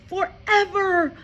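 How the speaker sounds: high, loud, slow wail; cried. A narrator's reading. Matilda cries out to Miss Honey. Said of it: "Matilda cried".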